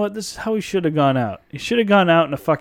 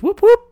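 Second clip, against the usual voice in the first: high, loud whoop-whoop siren; siren imitation; 6 dB louder than usual. Imitating the cops' siren for his co-host, high and loud. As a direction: mimic a police siren.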